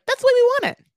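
high, loud squeal of the owners; squeaky mock voice. Mocking the owners in a loud high squeal. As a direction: pitch it way up, mocking them. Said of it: "they must be like".

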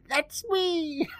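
put-on very high, screechy old lady squeal; mock excited scream. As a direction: imitate an overjoyed old woman screaming. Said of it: "stands up screaming".

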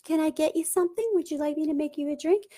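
high, eager, apologetic people-pleaser voice; high anxious voice, rushing at the end to offer a drink; fawning people-pleaser. Retold or imitated. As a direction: gush and grovel to keep them happy. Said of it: "Fawning is like".

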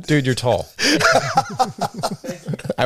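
talking through real laughter; laughing. Real, not acted. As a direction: laugh while you say it. Laughing about height.

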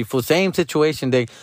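ordinary talk

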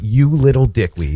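low, mild mock scolding; retold mom's yell. Mom to little sister, mildly imitated scolding. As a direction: imitate a mom telling off a kid, without yelling. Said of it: "she was yelling at my little sister".

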